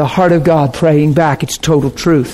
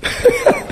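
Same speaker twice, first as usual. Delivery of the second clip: short high laugh; 4 dB quieter than usual. Real, not acted. Fond laugh after line.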